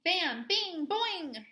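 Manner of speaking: ordinary delivery, nothing marked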